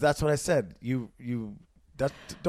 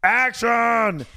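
loud, high shout of action; director's call; 8 semitones higher, 7 dB louder than usual. Shown as a demonstration. Repeating it on request to start a scene, director's call. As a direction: holler it like a film director. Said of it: "do action again".

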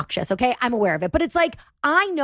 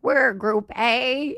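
put-on elderly voice; 3 semitones lower, much slower than usual. Elderly couple voiced in story.

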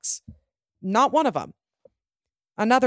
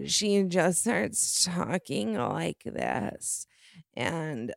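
very slow, soft, slurred raspy impression; mock a tipsy would-be seductress, dragging every word; 5 dB quieter, much slower than usual.

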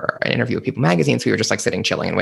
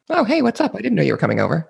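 mock surprised; 6 semitones higher than usual. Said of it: "I'm like".

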